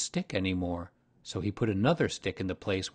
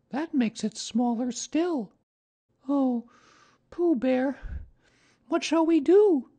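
put-on very high little-boy voice; slow, helpless lament in a child's pitch; Christopher Robin, dismayed child; 5 dB louder, slower than usual. A narrator's reading. Christopher Robin turns to Pooh Bear for help, dismayed high child voice.

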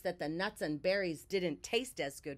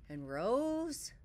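building up; 5 semitones higher than usual. A narrator's reading. Feeling rising inside Bear.

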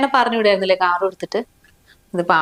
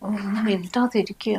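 hushing; 7 dB quieter than usual. Acted. Wife silencing husband so her mother won't hear, hushed. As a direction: hush him under your voice.

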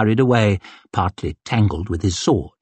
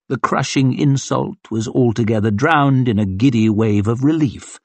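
plain reading voice

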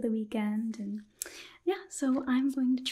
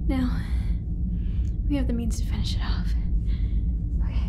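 louder spoken roleplay, giving orders; sci-fi crew member; 6 dB louder than usual. Acted. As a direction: call out commands to the ship computer, louder. Sci-fi roleplay, hunting the alien.

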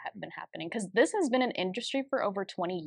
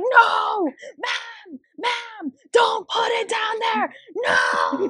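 much higher, louder, desperate mock shout; imitating a frantic scream; 7 dB louder, much slower than usual. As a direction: scream at the old lady to stop.

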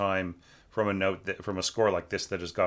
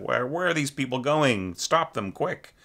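lightly voiced alarmed onlooker, higher; mock panic; 5 semitones higher than usual. Teacher playing a bystander alarmed at the runaway strings. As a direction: cry out half-playfully to stop them. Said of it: "as opposed to just".

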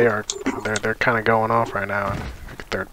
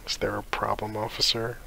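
hushed mock officer line; whispering; 10 dB quieter than usual. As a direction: whisper it like a nervous driver. Mock cop stop line, whispered.

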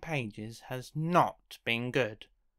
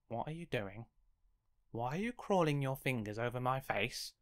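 usual speaking voice

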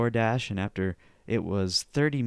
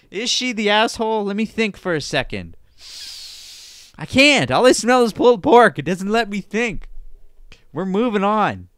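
high, loud, put-on exasperation; mock frustration; 10 semitones higher, 9 dB louder than usual. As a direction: cry out in playful helplessness. Mock frustration, unable to think.

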